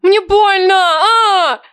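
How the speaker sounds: loud high scream, close to crying; screaming in pain. Acted. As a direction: wail as if scalded. Героиня кричит от обжигающей воды. (крик боли со слезами)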